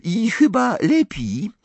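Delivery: high, lofty condescension; Fleur. A narrator's reading. Fleur explains her school's exams, condescendingly superior. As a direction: talk down with gracious superiority. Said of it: "powiedziała Fleur tonem łaskawej wyższości".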